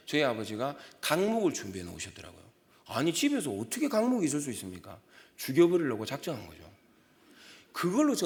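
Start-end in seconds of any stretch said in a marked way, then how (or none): none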